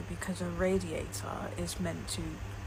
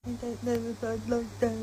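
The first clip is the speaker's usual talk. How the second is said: higher, lightly mocking valley-girl echo; mock American echo; 5 semitones higher than usual. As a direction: parrot her back, teasing. Echoing the American questioner mockingly.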